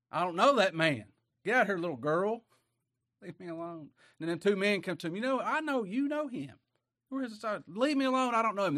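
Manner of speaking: loud, high, defensive denial; Peter's denial. Acted. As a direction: push them off in a panicked brush-off.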